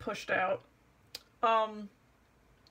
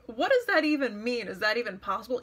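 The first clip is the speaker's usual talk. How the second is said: ordinary talk, nothing marked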